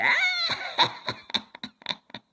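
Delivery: high shrieking witch cackle; evil cackle. Acted. Wicked fairy gloating over her spell, shrieking cackle. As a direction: cackle in triumph.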